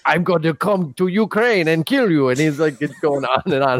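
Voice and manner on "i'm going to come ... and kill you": put-on higher Ukrainian accent threat; hammed-up menacing Ukrainian accent. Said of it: "he's doing his Ukrainian accent apparently".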